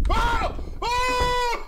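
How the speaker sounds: shrill panicked scream, high and loud; startled scream. Real, not acted. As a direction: scream from a sudden fright. Jump scare in horror game.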